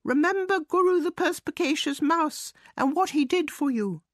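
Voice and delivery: high, proud little mouse calling out; mouse calling back. A narrator's reading. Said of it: "he called back at them".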